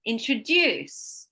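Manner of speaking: slow "introduce" with DUCE punched; stress on last syllable. Shown as a demonstration. Teacher correcting a guess, slow in-tro-DUCE for "introduce". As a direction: draw out and lift DUCE in "introduce". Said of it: "No, listening".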